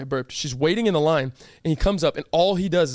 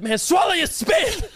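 high, slow mock yell; coach yelling; 11 semitones higher, slower than usual. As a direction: bark it like a fed-up coach.

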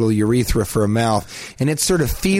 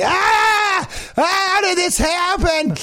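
sudden high screaming panic; panicked scream; slower than usual. Retold or imitated. Man staring at his pelvis, shrieking in horror. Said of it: "you're just looking at your pelvis going".

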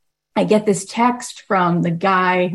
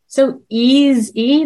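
drawn-out, lifted "so easy"; slowed emphasis; 6 semitones higher, 3 dB louder, much slower than usual. Real, not acted. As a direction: stretch "so easy" out slowly and higher. Stressing how easy it is.

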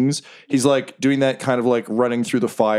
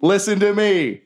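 higher, grand speechmaker voice; rally the crowd like a leader; rousing union speech; 10 semitones higher than usual. Acted. Jerry rallying his fellow goblins, grand and higher. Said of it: "He's like".

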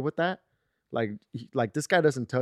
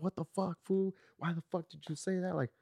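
mimicking a mad friend, higher-pitched; retold angry cussing; 6 semitones higher, 4 dB quieter than usual. Friend calling back to cuss him out, mock angry. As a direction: act out an indignant, higher-voiced complaint. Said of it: "fucking cussed me out and got mad".

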